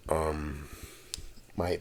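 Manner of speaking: usual speaking voice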